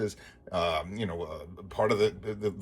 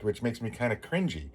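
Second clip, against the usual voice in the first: usual speaking voice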